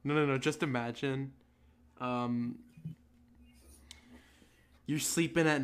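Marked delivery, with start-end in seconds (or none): none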